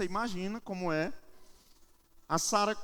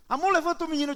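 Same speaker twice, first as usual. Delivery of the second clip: high, louder mock panic; wife in crisis; 9 semitones higher, 6 dB louder, faster than usual. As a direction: imitate a frantic wife urging her husband. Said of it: "minha esposa ficava em crise". (esposa aflita, imitada)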